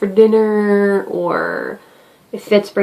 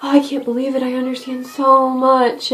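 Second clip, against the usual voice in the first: fake sympathy; 4 semitones higher than usual. Customer service worker to an angry caller, sugary fake sympathy. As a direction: lay the sorry on thick for the customer. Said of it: "That's a nice one that I like to use".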